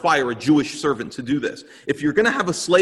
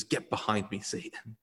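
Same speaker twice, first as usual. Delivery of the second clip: low, soft and firm, fading to a whisper on Satan; a quiet command that drops to a breath; hushed stern rebuke; 5 semitones lower, 12 dB quieter than usual. Retold or imitated. Jesus answers Peter's rebuke.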